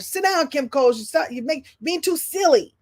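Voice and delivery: half-serious telling-off; mock scolding. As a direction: imitate someone fed up with a class clown. Mimics disapproving schoolmates scolding her.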